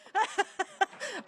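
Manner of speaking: giggling helplessly; high laugh. Real, not acted. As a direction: keep laughing at the screen. Still laughing at close-up.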